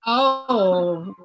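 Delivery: usual speaking voice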